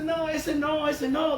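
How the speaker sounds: high, loud "not that one" protest; a petulant king saying no; king refusing. Retold or imitated. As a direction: protest like a stubborn king brushing the prophet off. King rejects the prophet Micaiah.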